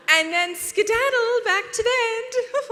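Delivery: put-on overly sweet mom shooing the kid, strained; sugary parent voice. Parent hiding annoyance, shooing the girl back to bed in a sugary voice. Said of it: "You're like".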